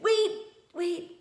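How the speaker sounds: put-on girl calling after the old woman; crying out. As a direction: stop her before she leaves. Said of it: "she cried".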